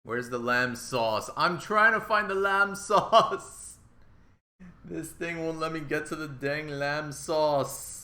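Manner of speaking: loud, drawn-out hammy yelling; mock angry shouting. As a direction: bellow it in exaggerated exasperation, rising in pitch. Mock-rage catchphrase about stuck device.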